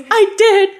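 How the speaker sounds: high, laughing mom voice; mock mom confessing, laughing; mom's voice. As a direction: squeak it as mom, giggling. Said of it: "she goes".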